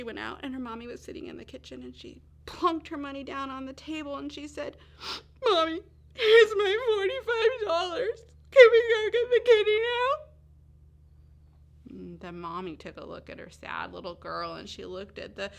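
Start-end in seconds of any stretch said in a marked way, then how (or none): 5.4-10.3 loud, high, crying plea; tearful child. Acted. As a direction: sob it out like a little girl giving up everything. Tearful girl offers her money.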